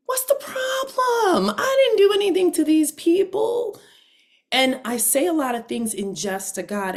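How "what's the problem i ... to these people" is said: higher, louder indignant protest to God; exasperated outcry. Retold or imitated. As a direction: complain loudly and high, fed up. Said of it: "So I said".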